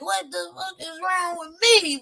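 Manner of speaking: high-pitched mock crying voice; high, whiny imitation of crying; retold crying. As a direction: wail it as a retold meltdown. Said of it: "crying like a mug yesterday".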